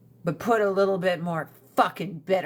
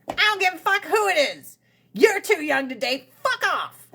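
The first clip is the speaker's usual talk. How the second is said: higher, fast mock mom snapping; mom's tirade; 8 semitones higher than usual. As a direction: rattle it off like a fed-up mom. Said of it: "She's like".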